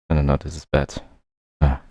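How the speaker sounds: ordinary talk, nothing marked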